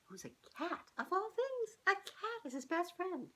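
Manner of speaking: ordinary delivery, nothing marked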